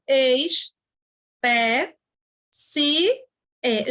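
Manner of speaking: "Ex, pé, se, é" with a pause after each syllable; "Ex, pé, se, é" syllable by syllable, last vowel separate; split into syllables. Shown as a demonstration. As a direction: chop the word into four drawn syllables. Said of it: "e não assim".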